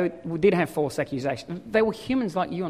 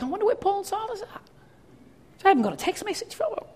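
high, fast, softer put-on voice; puzzled waiting friend; 11 semitones higher, 6 dB quieter, faster than usual. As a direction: wonder aloud in a comically high voice. Voices waiting friend in invented scene.

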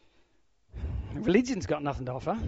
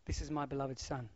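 much softer, lower gentle declaration; soft voice of God; 4 semitones lower, 11 dB quieter than usual. Pretend. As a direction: say it hushed, as God speaking to Peter. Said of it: "God goes".

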